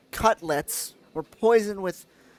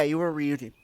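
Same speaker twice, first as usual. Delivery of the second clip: ordinary talk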